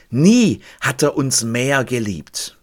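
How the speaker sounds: ordinary delivery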